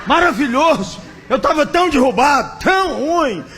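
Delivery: high, loud, gushing rapture; ecstatic friend. Retold or imitated. Friend raving to Jeremias after the service, loud and ecstatic. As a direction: overflow with joy about the service. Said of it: "O primeiro cara disse".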